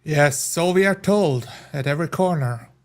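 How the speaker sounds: ordinary talk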